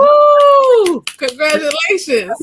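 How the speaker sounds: loud, high whoop into congratulations; excited cheer. Real, not acted. Host congratulates guest on sorority induction, loud excited cheer. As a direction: whoop and cheer them on, voice soaring.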